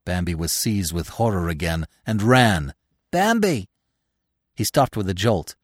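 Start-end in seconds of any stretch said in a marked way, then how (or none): 3.1-3.7 high-pitched call. A narrator's reading. Gobo, fallen in snow, calls Bambi, calling out. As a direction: call his name from a way off. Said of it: "A deer was calling him".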